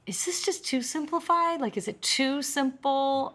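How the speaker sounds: wondering aloud if it's too simple; doubtful self-questioning. Retold or imitated. Self-doubt quote, high soft.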